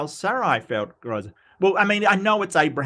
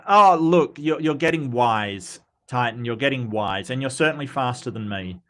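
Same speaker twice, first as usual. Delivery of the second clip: ordinary talk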